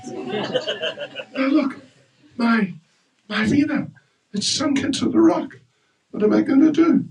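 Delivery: high, fretful half-laughing panic; panicked. Acted. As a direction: wail about the lost veena, lightly laughing. Sage panics over his sunk veena.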